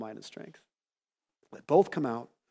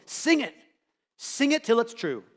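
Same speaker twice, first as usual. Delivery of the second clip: urging call; 6 semitones higher than usual. Real, not acted. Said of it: "exhorting congregation".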